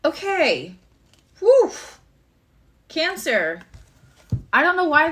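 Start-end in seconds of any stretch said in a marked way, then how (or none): 1.4-1.7 loud, high-pitched "whew"; startled, high exhaled hoot; high whoop. Real, not acted. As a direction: let out a sudden high, loud whoop of reaction. Reacting to a drawn card.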